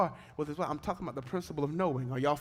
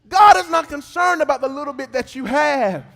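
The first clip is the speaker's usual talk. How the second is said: rising, much louder sung preaching cadence over music; full-force preaching; 10 semitones higher, 18 dB louder than usual. Acted. Pastor preaching to the congregation, sung and shouted.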